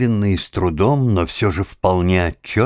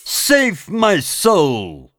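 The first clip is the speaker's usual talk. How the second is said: put-on high, slow drunken calling out; drunken shout; 11 semitones higher, much slower than usual. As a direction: belt the English words out, drawn out. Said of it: "и вдруг он крикнул".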